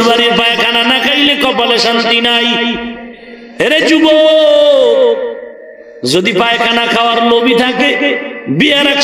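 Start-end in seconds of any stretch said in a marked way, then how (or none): 3.6-5.2 calling out. Real, not acted. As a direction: call out across the crowd. Preacher calling out to youths.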